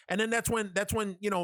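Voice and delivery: ordinary talk, nothing marked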